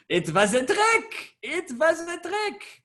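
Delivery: high, drawn-out mock exclamation; playful high-pitched put-on voice; playful singsong. Teasing a student who caught on.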